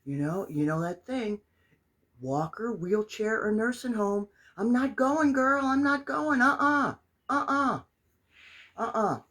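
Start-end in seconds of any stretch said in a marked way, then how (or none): 4.6-9.2 loud, high emphatic no; defiant refusal. Real, not acted. Telling viewers she refuses a nursing home, loud and defiant. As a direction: dig in and refuse flatly, sassy.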